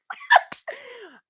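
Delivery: sudden high burst of laughter; loud high laugh. Real, not acted. Laughs at her own quote.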